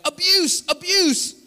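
loud, high-pitched mock accusation; mock outcry. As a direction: cry out like scandalized onlookers.

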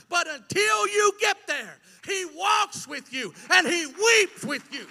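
shouted, high climactic preaching; full-force preaching. Real, not acted. Preacher to congregation at the sermon's peak, shouting. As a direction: whoop it to the rafters.

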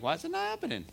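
high-pitched plaintive question; mock complaint. Preacher mimics a believer gone complacent, high mock complaint.

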